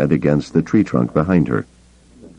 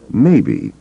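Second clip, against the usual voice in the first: hesitant, drawn-out maybe; said slowly; 5 semitones higher than usual. A narrator's reading. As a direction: ponder it as you say it. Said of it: "Skywalker said slowly".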